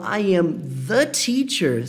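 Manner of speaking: "the" slow and marked in "I am the teacher"; "I am the teacher" with "the" drawn out; stress on the. Shown as a demonstration. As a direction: lean on "the", spoken slowly. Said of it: "Quando eu falo".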